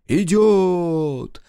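high, loud drawn-out echo; crowd bark. A narrator's reading. Crowd echoes the leader, high shouted chant. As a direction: cry it back as a chanting crowd. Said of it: "гаркнула в торе ему толпа".

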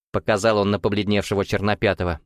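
usual speaking voice

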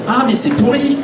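high mock indignant cry; sudden high exclaim of disgust; mock outrage. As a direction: play the shocked American author. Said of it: "ils font".

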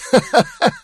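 high-pitched crazed laughter; maniacal laugh. A narrator's reading. Man gloating over her failure, maniacal laughter. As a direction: laugh high in manic glee.

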